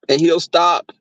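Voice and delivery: ordinary delivery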